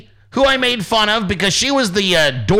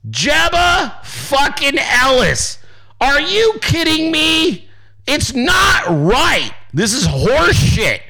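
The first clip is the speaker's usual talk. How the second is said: much higher, slow indignant wail; hammed-up outrage; 8 semitones higher, slower than usual. Streamer rants to chat about being blocked, high slow mock outrage. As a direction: stretch each word like a wounded protest.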